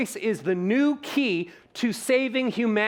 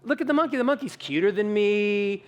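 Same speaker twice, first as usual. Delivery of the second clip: put-on much higher and bright, babyish; mock presenter cooing; 6 semitones higher, 5 dB louder than usual. Speaker mocks a presenter pointing at cute slides, cooing. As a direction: coo at the slides like at a toddler. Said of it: "and then say this".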